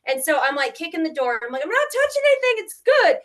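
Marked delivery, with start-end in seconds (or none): 1.6-3.2 high, loud, hurried retort; defensive shout. Retold or imitated. House hunter answering her worried sister, defensive shout. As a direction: fire back a shrill-pitched, rushed reassurance.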